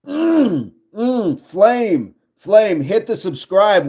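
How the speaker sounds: high, savouring hums, then an eager plea; delighted appreciation. Real, not acted. Streamer thanks Flame for a compliment, delighted and high-pitched. Gushing over viewer's compliment.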